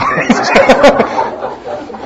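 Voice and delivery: a high, breathless laugh; airy laugh. Real, not acted. Preacher laughs at his own joke, airy and high.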